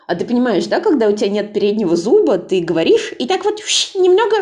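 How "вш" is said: sharp whistled hiss through teeth; whistling hiss. Shown as a demonstration. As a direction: whistle air through the gap. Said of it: "присвистываешь".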